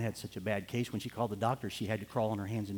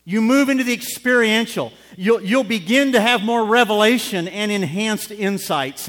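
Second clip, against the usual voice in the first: higher and louder still, hammering it; even bigger preaching shout; 9 semitones higher, 13 dB louder than usual. Real, not acted. Preacher driving the point home, shouting. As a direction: push the repeat harder.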